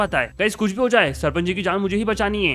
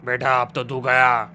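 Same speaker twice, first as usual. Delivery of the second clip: deep, low evil criminal voice; menacing villain; 9 semitones lower, slower than usual. Acted. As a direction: growl out threats like a killer. Villain finishes guard.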